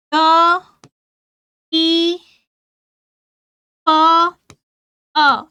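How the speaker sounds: "प, र, अ, द, ई, प, अ" as isolated sounds, long pauses between. Shown as a demonstration. Teacher splitting प्रदीप for pupils to write, sound by sound. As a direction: call out each sound of "प, र, अ, द, ई, प, अ" separately and firmly. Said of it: "जैसा sound सुनाई दिया".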